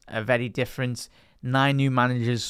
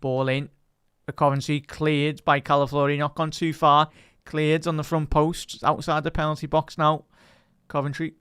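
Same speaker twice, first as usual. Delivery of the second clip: higher, louder quick match commentary; live play-by-play; 3 semitones higher, 5 dB louder than usual. Acted. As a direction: call the action as it happens.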